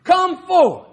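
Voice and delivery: loud cry. Retold or imitated. Preacher shows how Jesus cried out to Lazarus, loud cry. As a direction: call out as if to someone in a tomb. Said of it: "he cried out with a loud voice".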